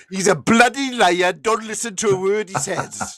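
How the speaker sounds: high, loud indignant outburst; urgent denial. Acted. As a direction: blurt out the punchline as a farmer desperate to discredit the sheep. Panicked farmer's punchline about sheep.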